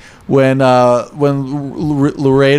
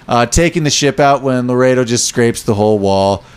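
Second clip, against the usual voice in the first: ordinary talk, nothing marked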